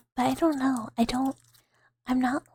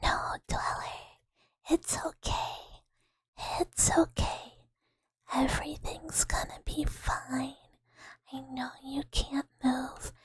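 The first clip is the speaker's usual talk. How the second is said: calm a frozen sleeper, gently; sleep paralysis angel. Pretend. Soothing sleep paralysis angel whispering to a frozen sleeper. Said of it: "I'm like".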